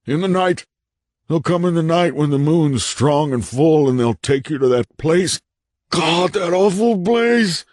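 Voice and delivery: high warning building to a wail; haunted man, terrified raving. A narrator's reading. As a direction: rave in horror at the memory.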